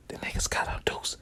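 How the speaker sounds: hushed secret whisper. Retold or imitated. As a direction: lean close and whisper it. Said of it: "I whisper".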